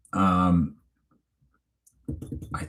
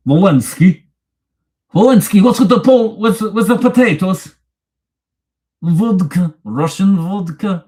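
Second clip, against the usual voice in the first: mock Russian accent; 11 semitones higher, 8 dB louder than usual. Streamer jokes with chat in a loud mock Russian accent. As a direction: play a cartoon Russian joking about vodka. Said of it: "I tried to do Russian accent".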